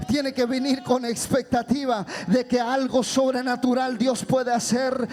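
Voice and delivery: loud, relentless exhortation; full-force preaching. Real, not acted. Preacher urging congregation to come with faith, full-force preaching. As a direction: drive every phrase home at full voice.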